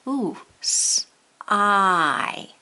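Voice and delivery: "s-" then "I" split apart and held; sound isolation. Shown as a demonstration. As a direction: pull out the I sound alone. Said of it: "And say the word sigh".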